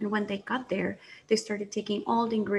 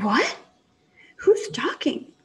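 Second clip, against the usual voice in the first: scared; 4 semitones higher, 5 dB louder, slower than usual. Acted. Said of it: "she got scared".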